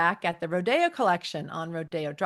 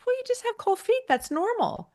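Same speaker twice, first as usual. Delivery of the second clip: much higher falsetto, lightly mocking the fiancé; mock Terrence; 4 dB louder than usual. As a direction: squeak out his reassurance in a put-on high voice. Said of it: "Terrence says".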